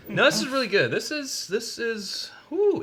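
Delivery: high, excited tasting reaction. Real, not acted. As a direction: rave about the whiskey. Reacting to the whiskey.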